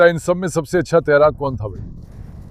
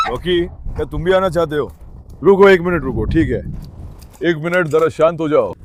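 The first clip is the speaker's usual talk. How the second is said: ordinary talk, nothing marked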